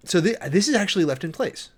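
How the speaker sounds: usual speaking voice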